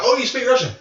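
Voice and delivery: high, loud surprised question; exaggerated false-hope delight; mock hopeful. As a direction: jump up in pitch as if thrilled. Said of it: "give her some false hope".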